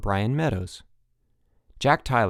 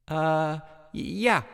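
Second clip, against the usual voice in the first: hesitant, higher-pitched stutter; stammering; 5 semitones higher, slower than usual. A narrator's reading. Mike put on the spot for comment, stammering. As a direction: stumble over the first sound, unsure. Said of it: "stammered Mike".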